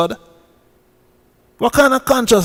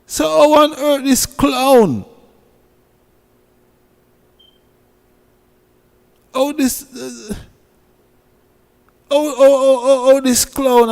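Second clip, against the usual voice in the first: higher, stammering how how how in disbelief; ranting at a TikTok clown, higher and stammering; outraged disbelief; 7 semitones higher than usual. Real, not acted. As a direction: sputter with indignation.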